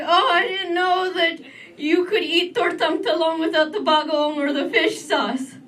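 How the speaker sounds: a hint of nervous candidate. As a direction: nervously flatter the boss. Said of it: "I got all nervous".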